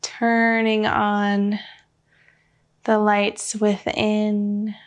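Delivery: slow, even meditation voice with long pauses; unhurried guidance, leaving room for breath between phrases; guided meditation. Real, not acted. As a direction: lead them gently inward, phrase by measured phrase.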